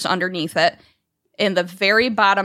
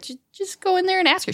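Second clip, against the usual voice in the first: urging; 12 semitones higher, faster than usual. Retold or imitated. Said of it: "And I was like".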